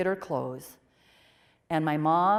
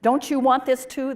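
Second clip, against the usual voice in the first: raised, urgent plea to God, higher and louder; pleading with God; 6 semitones higher, 7 dB louder than usual. Retold or imitated. Young woman begging God aloud, urgent and raised. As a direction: beg heaven to act, voice climbing. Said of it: "And I can remember saying".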